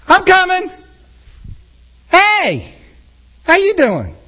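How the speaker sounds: a distracted sinner answering Jesus's call; breezy sinner reply. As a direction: promise to come, stay put. Sinner replies, not moving.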